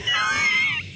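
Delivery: a very high burst of laughter; shrieking laugh. Real, not acted. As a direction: cackle at your own antics. Laughing at her own rant.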